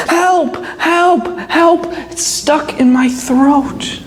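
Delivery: high, urgent wolf plea; acted cry for help, easing at the end; yelling for help. A narrator's reading. Choking wolf begs the crane for help, high and urgent. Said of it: "He starts yelling help".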